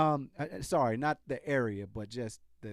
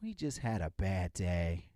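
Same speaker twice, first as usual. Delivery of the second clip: slow, lower, soft mock of the captain; understated parody of the official line; 9 semitones lower, 5 dB quieter than usual. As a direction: sneer the excuse slowly. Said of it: "after he says this whole spiel".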